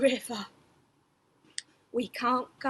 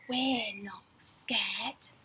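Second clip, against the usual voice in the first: slow breathy whisper, pretending to be brave; 6 semitones lower, 6 dB quieter, slower than usual. A narrator's reading. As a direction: whisper it slowly, not scared at all. Refrain whispered in storytelling.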